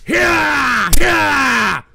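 shouting hoarse and loud at chat; angry yelling. Real, not acted. Streamer bellowing at trolls in chat, furious yell. Ranting streamer yells at viewers.

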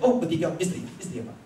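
ordinary delivery

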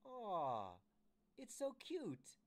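high, drawn-out gushing coo; cooing Rondella. Acted. Rondella fawns over the origami Vader, cooing high and slow. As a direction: coo loudly over something adorable. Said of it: "Rondella says".

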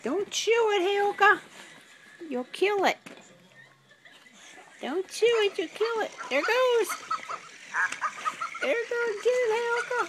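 high-pitched dog-talk voice; playful pet talk. Real, not acted. Owner telling dog not to kill toy, playful. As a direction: coo at the dog, pitched well up.

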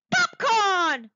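high, loud delighted cry; cried out. A narrator's reading. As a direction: shout it with sudden joy. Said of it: "cried Dexter".

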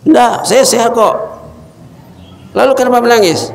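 high, changed voice; weeping friend's reply. Acted. The weeping companion answering his visiting friend, high and changed.

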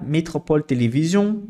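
normal talking voice

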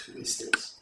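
hushed whisper of curiosity; whispered wonder. Acted. Fairy woman wondering at the sleeping queen, whispered. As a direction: breathe it almost silently over a sleeper.

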